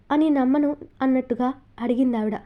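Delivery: ordinary delivery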